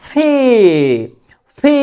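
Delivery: "ফি" with breathed P and long held i; drawn-out letter P. Shown as a demonstration. Teacher showing alphabet letters, drawn-out aspirated ফি. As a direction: hold the vowel of "ফি". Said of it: "একটু টান দিবেন".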